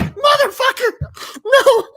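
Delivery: high, loud, slow shout of disbelief; disbelieving shout. Retold or imitated. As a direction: yell it out in happy shock. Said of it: "And I'm like".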